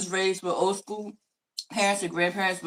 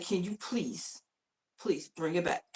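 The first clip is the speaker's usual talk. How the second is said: hushed, lower, measured plea; restrained teacher voice; 3 semitones lower, 12 dB quieter, slower than usual. Retold or imitated. Teacher pleading with the class to settle, quiet and restrained. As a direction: coax the class back softly. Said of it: "trying my best not to raise my voice".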